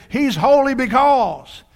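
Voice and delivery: high, loud exclamation of praise; heavenly being calling out. Retold or imitated. Preacher voices one heavenly being to the others, crying out. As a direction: cry it out slowly and forcefully. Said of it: "I can hear one of them saying".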